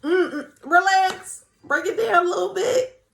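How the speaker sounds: high, loud teasing "relax" to a confident man; mock-scold him to tone it down; hyped-up admiring tease; playful scolding. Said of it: "I be like".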